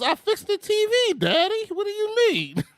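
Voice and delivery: put-on very high little-kid voice; high-pitched mock toddler voice; imitated young son. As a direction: play an innocent child answering Daddy. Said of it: "He goes".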